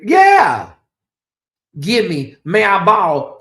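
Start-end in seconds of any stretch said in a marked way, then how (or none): 0.0-0.7 loud yeah. Real, not acted.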